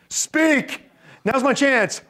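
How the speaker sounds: high-pitched, excited burst; eager pouncing. Acted. Parent seizing the child's sigh to talk, eager. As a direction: jump in like a parent seizing the opening.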